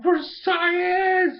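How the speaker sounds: high, loud, hammed-up war cry; heroic battle cry. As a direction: yell it while charging in. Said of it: "He's like".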